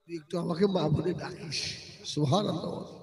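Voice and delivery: soft, close preaching voice; hushed sermon. Acted. Preacher telling a sad story to the crowd, hushed and tender. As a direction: draw the listeners in, hushed and tender.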